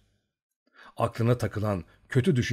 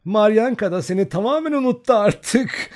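put-on much higher, louder girlish teasing; a giggly girl needling him; thin laughing voice; 12 semitones higher, 7 dB louder than usual. A narrator's reading. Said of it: "ince sesiyle gülmekten kırılan". (Ustenka ince sesle, gülerek takılıyor)

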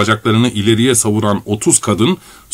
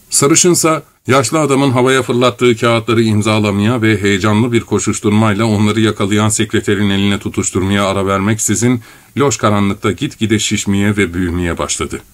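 ordinary delivery, nothing marked

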